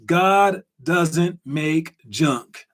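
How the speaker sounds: slow, word-by-word delivery; quote of the day. Shown as a demonstration. Host announces the quote of the day, slow and deliberate. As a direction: spell it out slowly, one word at a time.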